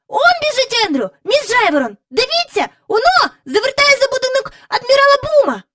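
put-on high, loud boy shouting the news; excited calling out. A narrator's reading. Michael calls out to Miss Lark that he sees Andrew, high and excited. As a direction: point and yell, he's found.

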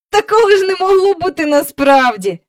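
put-on high, loud boyish scoffing; mocking. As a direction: jeer in open disbelief. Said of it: "насміхався трохи згодом Майкл".

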